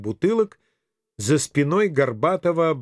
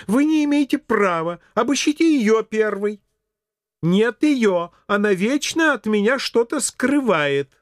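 high-pitched, indignant character voice; indignant protest; 7 semitones higher than usual. A narrator's reading. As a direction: object hotly, pitched well above narration. Twins protest against being searched. (возмущённый протест высоким голосом)

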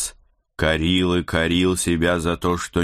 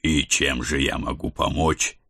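ordinary delivery

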